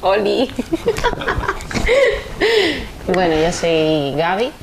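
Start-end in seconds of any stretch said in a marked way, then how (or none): 0.6-3.0 high, embarrassed giggle. Real, not acted. Guest giggles shyly before introducing herself, high giggle.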